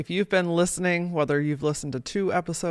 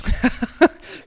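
breathy laugh; 7 semitones higher, 6 dB louder than usual. Real, not acted. Laughing at own story.

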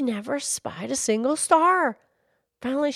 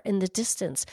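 plain reading voice

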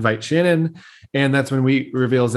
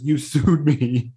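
slow mock accusation; slower than usual. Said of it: "And he says".

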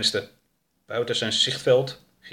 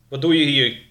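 put-on stern older man, loud demand; loud questioning from an armed older man; 4 semitones higher, 6 dB louder than usual. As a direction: challenge a stranger loudly. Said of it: "vroeg de oudere man".

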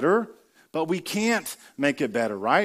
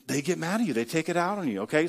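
ordinary talk

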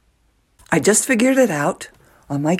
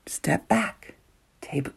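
soft whispered words; 9 dB quieter, slower than usual. Shown as a demonstration. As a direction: hush it like calming yourself. Advice repeated, now whispered.

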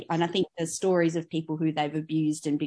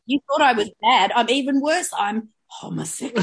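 camp villain; 4 semitones higher, 5 dB louder than usual. Retold or imitated. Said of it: "mocking villain reveal".

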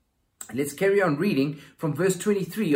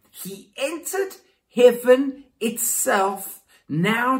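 much higher, louder, slow proclamation; proclaiming; 9 semitones higher, 9 dB louder, much slower than usual. Acted. Preacher reading Hebrews aloud, slow ringing proclamation. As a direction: ring it out to the congregation.

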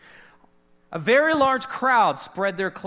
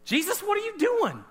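bewildered cry; 11 semitones higher than usual. Retold or imitated. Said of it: "the disciples were like".

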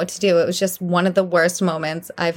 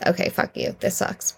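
muttered resentment; 2 semitones lower, 5 dB quieter than usual. Retold or imitated. Retold resigned answer under breath.

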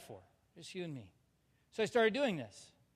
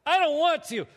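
put-on high, loud, childish refusal; a loud, high pouting whine; whiny tantrum, replayed; 8 semitones higher, 9 dB louder than usual. Shown as a demonstration. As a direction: act out the sulky refusal again for the crowd. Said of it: "Did I say it that way? Yes".

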